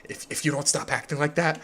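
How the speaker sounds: softly imitating a whispered threat; a hushed, mock-stern warning; whispering. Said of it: "like, whispering".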